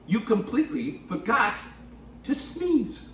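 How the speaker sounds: put-on lively read-aloud voice for kids; storybook reading. A narrator's reading. Storytime host reading a picture book to kids, lively and animated. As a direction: read it to the children with big character voices.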